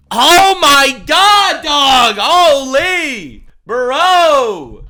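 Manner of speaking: high, drawn-out exclamations of disbelief; loud disbelief. Real, not acted. Commentator reacting to a politician's outrageous quote, loud disbelief.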